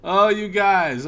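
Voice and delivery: loud mock guffaw into the words; imitated laughing. Fourth roommate comes out to the guys, imitated laughing. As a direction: imitate someone laughing as he arrives, loudly. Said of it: "comes out laughing".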